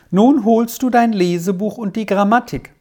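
commanding. A narrator's reading. Malva orders Squirrel to pack, commanding. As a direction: give a firm instruction. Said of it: "befahl Malva".

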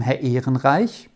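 usual speaking voice

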